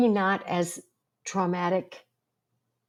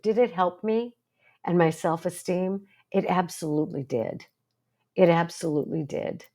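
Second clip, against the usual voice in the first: normal talking voice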